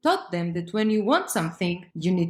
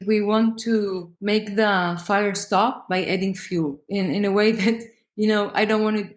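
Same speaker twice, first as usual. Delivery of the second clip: ordinary talk, nothing marked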